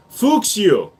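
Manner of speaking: Fuchs you said as "fooks you", German way; a mock German "Fuchs you"; German pun. Shown as a demonstration. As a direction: Fuchs punched with falling tune, like German Fuchs. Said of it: "F U C H S".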